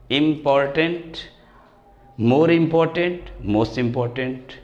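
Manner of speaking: very slow, each form spaced out; slow model reading. Shown as a demonstration. Teacher models the degrees for the class, very slowly. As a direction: read the three forms like a dictation. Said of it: "teacher models comparison forms".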